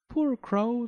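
high, tender pity. A narrator's reading. Girl pities the captured crow, in high sympathy.

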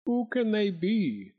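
high, slow baffled question; helpless. A narrator's reading. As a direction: wonder helplessly, drawing it out. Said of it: "said Swine helplessly".

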